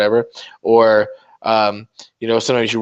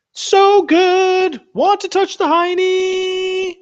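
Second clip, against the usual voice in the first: much higher, slow falsetto squeal; squeaky falsetto; 4 dB louder, much slower than usual. Pretend. Streamer gloating about his streak: squeaky falsetto. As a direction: squeal it up high, teasing.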